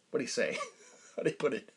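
ordinary talk

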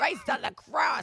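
loud, slow, high Christ on the cross; exasperated shout. Retold or imitated. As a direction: belt it out exasperated. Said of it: "I'll be like".

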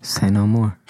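unvoiced conspiratorial whisper. [name] agrees to the plot, whispering. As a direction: whisper back in on it.

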